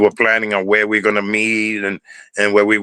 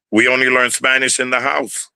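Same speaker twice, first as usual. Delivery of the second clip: normal talking voice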